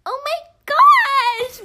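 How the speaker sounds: high, slow, loud thrilled squeal; drawn-out gushing oh my gosh; excited squeal. Retold or imitated. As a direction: squeal it like a giddy fourth grader. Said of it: "And I'm like".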